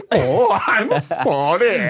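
drawn-out, slow mock apology; sarcastic apology. Mother mocks her angry son, slow and sarcastic. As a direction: stretch out a fake, disrespectful sorry.

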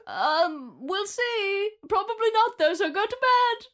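playful Santa shooing to bed. Acted. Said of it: "did an award-winning performance being Santa".

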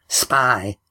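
a mildly spat accusation. Acted. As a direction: spit the word out, understated. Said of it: "spat the grandmother".

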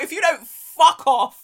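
loud, high, slow outburst; retold exasperation. Cohost recalling reaction to [name]'s message, retold exasperation. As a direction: drag out the indignant retort.

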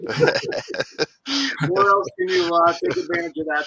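laughing through the words, slow; chuckling while getting the line out. Real, not acted.

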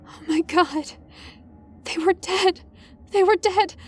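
high, breaking voice near tears; horrified crying. A narrator's reading. Narrator finding dead classmates, crying in horror. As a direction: choke on the shock of it.

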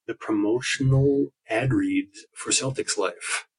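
low, slow announcer read; deep old radio serial announcer. Pretend. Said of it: "That's my radio announcer voice".

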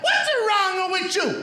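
slow, high, shouted scolding; mom scolding. Retold or imitated. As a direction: drag out the scold at him. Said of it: "Quick impression of my parents".

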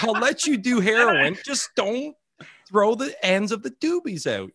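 high-pitched, exasperated mock bargaining; pissed-off uncle. Cool uncle bargains with his guests, mock exasperated. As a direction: imitate a fed-up uncle pleading with reckless guys. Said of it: "pissed off".

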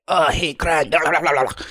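high mock whine of the neighbor. Neighbor scoffing at her threat, high mocking voice. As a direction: parody his scoff, high. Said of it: "he was like".

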